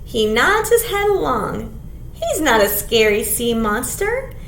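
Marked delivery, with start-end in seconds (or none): none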